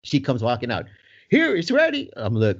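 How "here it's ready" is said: put-on high, brisk old lady voice; bakery lady handing the dad the box, high voice; mock old lady. Chirpy high handover.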